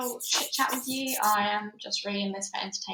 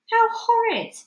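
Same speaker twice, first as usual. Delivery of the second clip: put-on high, loud childish horror; horrified boy; 14 semitones higher, 8 dB louder than usual. Horrified boy reacts to his grandmother, high and loud. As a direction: cry it out high in disgust.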